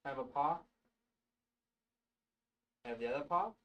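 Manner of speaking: high soft coaxing pet voice. Real, not acted. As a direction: gently coax the cat to sit. Training cat to sit.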